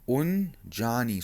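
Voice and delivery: slow, careful "Unjani" with the stress held on "ja"; model Zulu word. Shown as a demonstration. Teacher models the greeting for students, slow careful Zulu. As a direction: spell it out for the class, pronounce "Unjani" slowly and evenly. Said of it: "You say".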